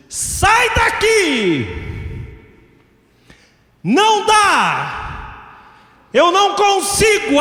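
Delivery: high, drawn-out desperate cries; shouted banishment. Acted. Pharaoh ordering Moses to leave, shouting. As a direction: drive them out at full voice.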